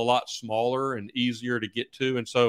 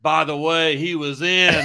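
mock holler, raised and louder; retold yell; 5 semitones higher, 6 dB louder than usual. Clinton store clerk needling the rival coach, retold yell. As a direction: deliver the punchline as a called-out jab. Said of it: "he yells at me and says".

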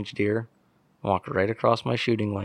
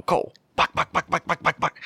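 high, hushed and urgent, buck repeated fast; urgent hushed call; 8 semitones higher than usual. Retold or imitated. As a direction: grandpa urgently hushing out that a buck is here. Grandpa waking him, spotting buck.

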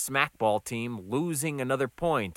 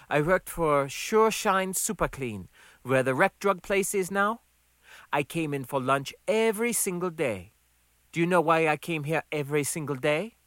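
raised-pitch woman character; Gonda's voice; 5 semitones higher than usual. Acted. Gonda reminds Max of the past, higher voice. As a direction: press the point in character.

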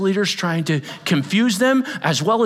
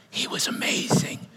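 awed whisper; 7 dB quieter than usual. Real, not acted. Whispered in awe.

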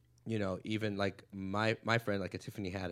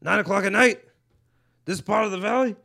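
building from plain to a loud, high Diaz riff; comic retelling the club legend's bit, building to a bellow; 9 semitones higher, 12 dB louder than usual. Retold or imitated. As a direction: work the room, growing louder and higher with each line. Acted Joey Diaz riff.

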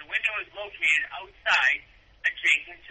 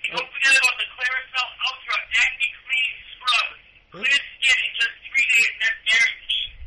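ad read; 8 semitones higher, 4 dB louder than usual. Pretend. Mock product plug on radio.

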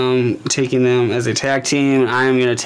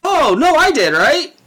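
surprised; 12 semitones higher, 4 dB louder, slower than usual. Real, not acted. Realizes the pick was taken.

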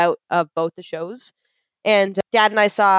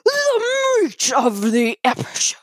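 slow, higher, drawn-out announcer voice; announcer intro; 6 semitones higher, slower than usual. Acted. Leading listeners into the main topic, hammy announcer. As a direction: announce the segment with mock grandeur. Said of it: "I guess that takes us to".

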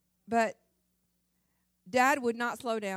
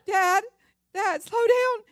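high, loud frightened pleading; scared sister yelling at dad to slow, high and loud; panicked sister; 12 semitones higher, 12 dB louder, slower than usual. Retold or imitated. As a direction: cry out to dad in alarm. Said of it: "she would be going".

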